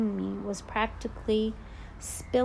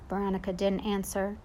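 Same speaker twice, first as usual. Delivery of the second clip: usual speaking voice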